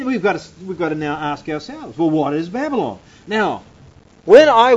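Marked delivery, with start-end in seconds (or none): none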